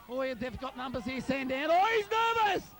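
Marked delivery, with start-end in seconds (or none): 1.7-2.7 high, loud excited call. Real, not acted. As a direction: blurt it out with a rush of excitement. Excited commentary on a tense moment.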